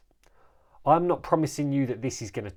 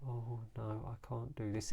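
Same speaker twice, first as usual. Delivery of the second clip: hushed low self-talk; quiet, slow, low; 5 semitones lower, 15 dB quieter than usual. Shown as a demonstration. Coach acting out a listener's negative thought, quiet and low. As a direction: murmur it low and very softly. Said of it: "I said it in a quiet tone, slowly".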